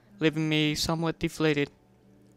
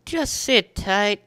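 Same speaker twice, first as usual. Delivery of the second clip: slow promise; 5 semitones higher, 5 dB louder, much slower than usual. A narrator's reading. Hero reassures Spike.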